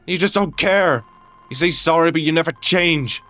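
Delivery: high, loud, heated outburst; furious accusation. Acted. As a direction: lash out, building to a peak on the taunt. Quarrel peaks, accusing friend.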